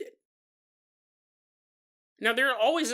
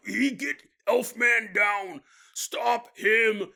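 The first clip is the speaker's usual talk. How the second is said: loud, higher put-on monster voice; shouting orc; 6 semitones higher, 4 dB louder, much slower than usual. Orcs under arrow fire, mock battle shout. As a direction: yell the order as a mock orc.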